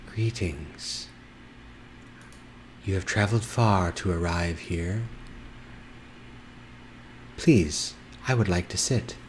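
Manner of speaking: lightly voiced, serene unicorn; musical unicorn voice. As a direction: speak as a gentle magical unicorn, unhurried. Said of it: "looks at you in this musical voice".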